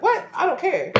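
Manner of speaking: high scornful "I don't care"; mock sassy dismissal; dismissive brush-off. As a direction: toss it off with attitude. Voicing dismissive listener again.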